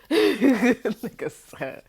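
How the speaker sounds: laughing through the words, slowly; words stretched by a big laugh; laughing hard. Real, not acted. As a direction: crack up mid-sentence. Bursts laughing retelling.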